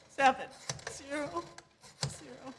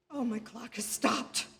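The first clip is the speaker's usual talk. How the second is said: ordinary delivery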